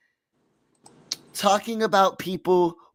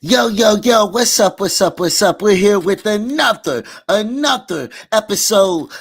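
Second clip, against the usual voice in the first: hype shout; 4 semitones higher, 7 dB louder than usual. Real, not acted. Host hyping show opening.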